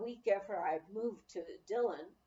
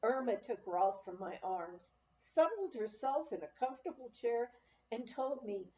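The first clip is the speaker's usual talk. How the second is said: usual speaking voice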